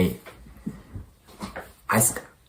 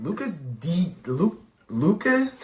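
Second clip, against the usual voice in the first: stunned disbelief; 6 semitones higher, 8 dB quieter, slower than usual. Real, not acted. Stunned repeat of name.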